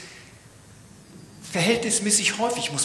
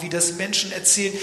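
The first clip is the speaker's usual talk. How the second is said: normal talking voice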